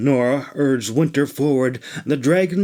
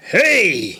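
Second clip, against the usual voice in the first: exclaiming; 5 semitones higher than usual. Acted. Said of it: "he exclaimed".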